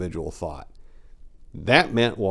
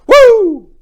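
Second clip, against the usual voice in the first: huge high Ric Flair woo, much louder; 16 dB louder than usual. Retold or imitated. Narrator celebrating a mud wrestling win, screaming Ric Flair woo. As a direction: let rip a wrestler's woo. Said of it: "made Ric Flair proud".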